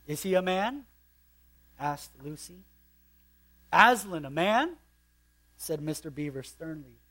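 put-on reading a children's story aloud; sermon read-aloud of a novel. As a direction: read the Narnia passage like a storyteller. Reading Narnia passage with character voices.

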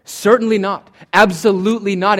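loud, high punch on "Certainly" and "Absolutely"; emphatic negative. Shown as a demonstration. Preacher spelling out an emphatic negative, forceful and emphatic. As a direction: drive home "Certainly not. Absolutely not." loudly. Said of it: "that's called an emphatic negative".

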